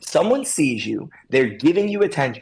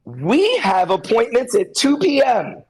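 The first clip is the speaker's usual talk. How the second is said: stiff announcement; 8 semitones higher, slower than usual. Retold or imitated. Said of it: "your post comes up and it's".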